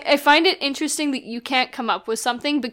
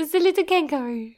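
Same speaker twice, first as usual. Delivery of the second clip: higher, put-on little French voice; French accent impression; 6 semitones higher, faster than usual. Friend joining in the Pulp Fiction quote, French accent imitation. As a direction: mimic a cute French accent, high. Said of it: "says that with her accent".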